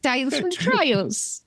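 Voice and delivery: high, slow hammed-up cockney accent. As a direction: parody a chimney sweep's cockney, slowly.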